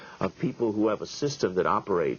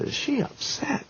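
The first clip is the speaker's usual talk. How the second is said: very high, drawn-out whispery squeal; squeaky disbelief, stretched out; mock incredulous; slower than usual. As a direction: imitate an aghast friend in a strained, breathy falsetto. Friend aghast, echoing his question.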